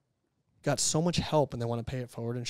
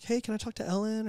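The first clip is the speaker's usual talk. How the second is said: confused caller; 10 semitones higher than usual. Retold or imitated. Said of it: "You'd get people calling and be like".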